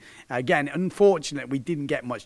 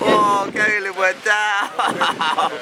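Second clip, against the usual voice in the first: laughing reaction; 5 semitones higher, 6 dB louder, much slower than usual. Real, not acted. Reacting to the crash.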